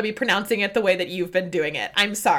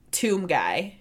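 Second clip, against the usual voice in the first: ordinary talk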